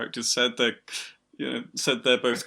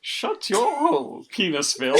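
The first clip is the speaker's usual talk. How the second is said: posh bastard voice; 6 semitones higher than usual. Acted. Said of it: "that's the voice".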